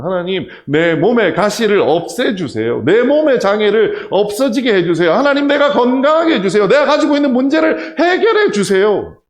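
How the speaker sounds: loud, high pleading, laughing into a shout; mock loud prayer. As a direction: ham up a desperate prayer. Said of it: "기도하잖아요".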